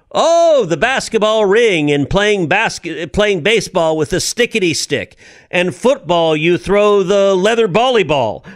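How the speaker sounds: lampoon him like a late-night host; mocking late-night hosts. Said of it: "They're like".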